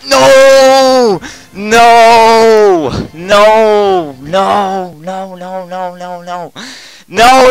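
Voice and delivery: shrieked no, very high and loud; desperate screaming. Real, not acted. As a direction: scream it out in total despair. Lost round, screaming in despair.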